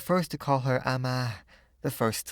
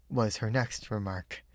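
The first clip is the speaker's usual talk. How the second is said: ordinary delivery, nothing marked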